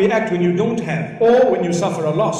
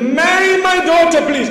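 imagined refusal; 6 semitones higher, 3 dB louder than usual. Retold or imitated.

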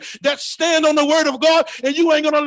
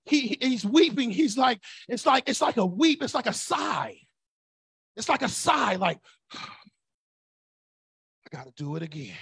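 soft sighing voice, much quieter; weary sigh; 2 semitones lower, 5 dB quieter than usual. Shown as a demonstration. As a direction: sigh it out like a tired parent. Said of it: "groanings mean ... weeping".

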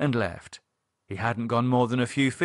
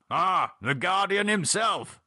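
higher, slow, plummy gentleman; hearty Gerald; 8 semitones higher than usual. Acted. Gerald meeting the Guardian, hearty and impressed. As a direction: greet grandly, stretching the words.

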